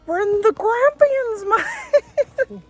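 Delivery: high, loud, slow correction; exasperated. Real, not acted. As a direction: stress the Grampians in disbelief.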